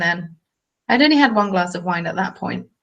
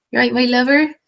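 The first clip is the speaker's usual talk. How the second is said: higher West Country greeting; West Country accent; 4 semitones higher, 3 dB louder than usual. Shown as a demonstration. Vlogger demonstrating her party accent, in West Country accent. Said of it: "I can do a West Country accent quite well".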